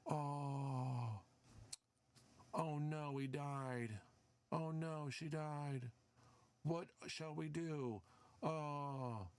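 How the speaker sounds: lightly voiced sarcastic mourner; mock mourning. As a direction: fake a sorry little lament. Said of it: "we're supposed to say".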